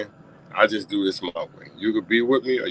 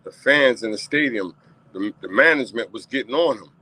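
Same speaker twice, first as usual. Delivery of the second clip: ordinary talk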